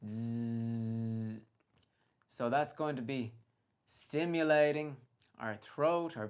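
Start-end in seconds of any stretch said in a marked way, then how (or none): 0.1-1.4 long buzzing zzz through the teeth; bee buzz. Shown as a demonstration. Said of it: "a buzzing noise".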